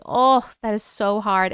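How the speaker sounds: normal talking voice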